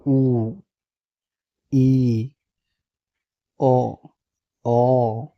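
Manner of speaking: "ऊ", "ई", "ओ" each held long on its own; teacher modelling isolated long "ऊ", "ई", "ओ"; vowel sound demo. Shown as a demonstration. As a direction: sound out "ऊ", "ई", "ओ" with pauses between. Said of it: "इस तरीके से आपको पढ़ना है".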